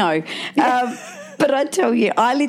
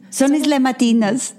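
mangled lyric in a mock Spanish accent; hammed-up Spanish accent; 4 semitones higher than usual. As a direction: put on a jokey Spanish accent. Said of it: "Oh, now it's Spanish".

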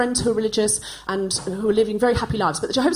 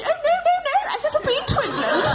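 much higher prissy parody, laughing; mocking imitation. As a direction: mimic the posh kid back to mock her. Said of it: "And they'd say".

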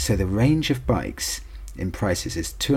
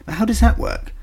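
baffled; 5 dB louder than usual. Real, not acted. Baffled by tractor result.

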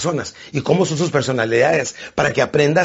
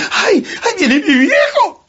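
high, laughing falsetto wife in mock dread; nervous wife; 4 dB louder, slower than usual. Wife seeing her strict husband come home, mock-frightened high voice. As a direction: squeak it out like a fretful wife.

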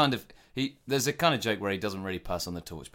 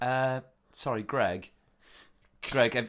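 hesitant, higher-pitched polite interruption; apologetic coworker; 5 semitones higher, slower than usual. Acted. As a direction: interrupt a busy colleague apologetically. Timid kitchen worker interrupting Greg.

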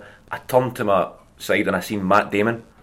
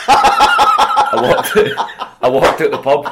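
laughing through it; 9 dB louder, much slower than usual. Real, not acted. Laughing at his own story.

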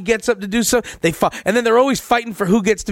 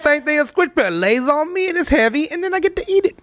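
much higher, playful and silly; high joking voice; 9 semitones higher than usual. Pretend. Joking about eating her watermelon, high playful squeak.

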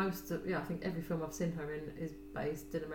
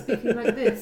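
put-on old-fashioned 1930s English; 5 semitones higher, 11 dB louder than usual. Friend mimicking Helen's sudden accent change, mock 1930s English. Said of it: "someone had obviously had a word".

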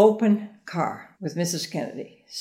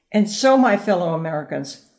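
quoting the famous line; 6 dB louder than usual. Shown as a demonstration. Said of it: "this is the famous line".

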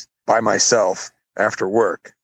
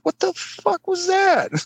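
very high, slow, stunned yelp; shocked outburst; much slower than usual. Retold or imitated. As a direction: replay the shock of a sudden blow to the head. Just got hit on head.

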